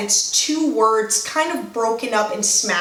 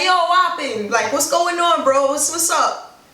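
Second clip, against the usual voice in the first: higher-pitched passing hello; casual greeting; 4 semitones higher than usual. Acted. Greeting a colleague in passing, casual. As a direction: toss out a quick friendly patois greeting. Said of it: "I gotta say hi to this guy".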